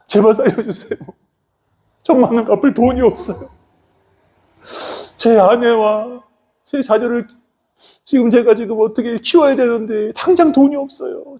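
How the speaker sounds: high, whining acted plea; desperate pleading. Debtor begs creditor in story. (높은 소리로 애처롭게 사정하듯)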